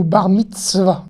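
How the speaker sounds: "mitzvah" with a marked ts; TS demonstration. Shown as a demonstration. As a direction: bring out the ts inside "mitzvah". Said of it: "Donc c'est vraiment ts".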